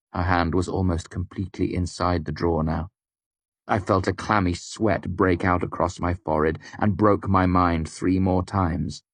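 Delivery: plain reading voice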